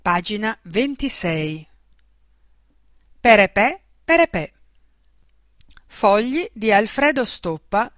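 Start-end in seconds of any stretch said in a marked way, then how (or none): 3.2-4.5 lightly sung-out trumpet imitation; imitated trumpet toot. A narrator's reading. Narrator opens the tale with a trumpet call, bright tooting imitation. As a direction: voice a little toy trumpet call. Trumpet fanfare opening the story.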